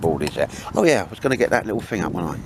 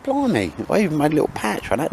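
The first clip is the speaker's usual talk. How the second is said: surprised thought; 7 semitones higher than usual. Retold or imitated. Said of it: "you think".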